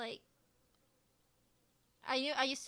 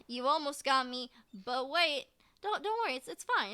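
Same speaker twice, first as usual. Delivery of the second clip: mock reassurance; 8 dB louder than usual. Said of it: "being like".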